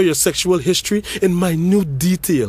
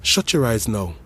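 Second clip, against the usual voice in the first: ordinary delivery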